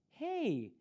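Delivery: sudden high "Hey" of surprise; surprised hey. A narrator's reading. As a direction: call out in surprise. Joey surprised to see her.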